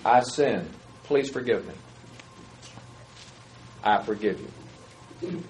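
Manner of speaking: soft, slow, earnest exchange; sincere confession and forgiveness. Shown as a demonstration. Preacher modelling confession and forgiveness, soft and sincere. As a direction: let each phrase land gently. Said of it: "just try that".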